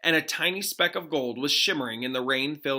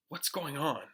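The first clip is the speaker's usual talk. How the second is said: hushed confusion; 7 dB quieter than usual. Acted. Sudden silence, confused.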